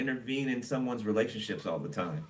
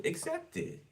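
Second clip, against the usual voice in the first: urging, squeaky; 6 dB quieter, slower than usual. Acted. Reader nudging viewer to accept blessings, squeaky high push. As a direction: accept it squeaked much higher and softer.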